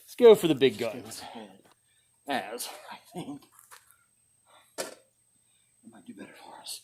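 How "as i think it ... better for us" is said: breathy half-voice aside, much softer; whispered aside. Real, not acted. Tester hoping the great axe does better, whispered. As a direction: say it under your breath.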